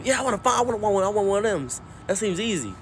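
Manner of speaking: put-on high-pitched, eager old man; eager granddad. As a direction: want it badly, like a kid. Granddad eagerly wants one.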